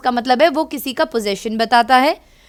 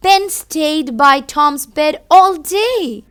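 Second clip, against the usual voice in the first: storybook reading; 3 semitones higher, 5 dB louder, much slower than usual. A narrator's reading. Reads storybook to children.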